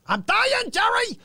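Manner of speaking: very high, loud mock scream; hammed-up scream climbing in pitch; sitcom scream. Greeting a viewer with a Seinfeld line, mock scream.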